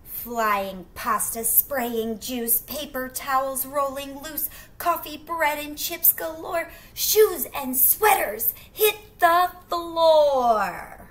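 loud, high, racing chaos; building wild excitement; wild tantrum. Acted. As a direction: let the mess explode.

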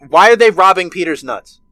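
normal talking voice

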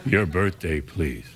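very low, falling off at please; bored low repetition; deadpan clerk again. Retold or imitated. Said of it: "He went".

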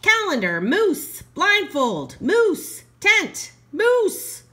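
loud bellowing moose voice. A narrator's reading. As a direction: bellow each word out. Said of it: "bellowed Moose".

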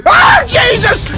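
high, loud, drawn-out shriek; scared shriek. Real, not acted. Cobra lunges at the player, scared loud shriek. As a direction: shriek like something lunged at you.

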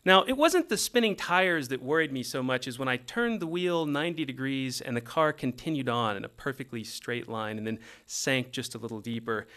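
ordinary talk

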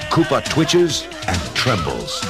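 usual speaking voice

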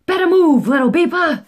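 loud high holler at the little truck. Acted. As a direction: shout it like a street sweeper.

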